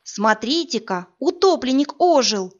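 excited youth calling out; young voice. Acted. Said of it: "незнакомый юный голос". (юный взволнованный голос)

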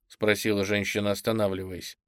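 usual speaking voice

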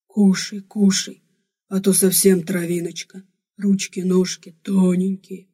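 doting, coaxing barbarian voice; blissful barbarian. A narrator's reading. Barbarian urges the thin girl to eat, doting and coaxing. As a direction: coax tenderly in the barbarian's full voice. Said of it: "блаженствовал варвар".

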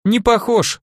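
high, doubting "not alike"; skeptical. Acted. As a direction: dismiss it with a smile. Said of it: "скептически покачал головой". (скептически)

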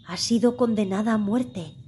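plain reading voice

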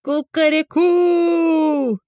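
long high crowing call; rooster crow. A narrator's reading. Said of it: "загорланил петух".

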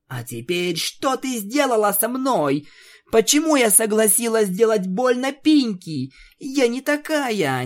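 high, anxious and upset; nervous. Acted. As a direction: plead in distress. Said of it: "нервничала Твайлайт". (нервно, взволнованно)

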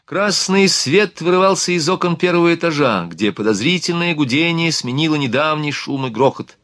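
ordinary delivery, nothing marked

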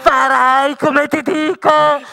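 commanding. Acted. Witch demands obedience.

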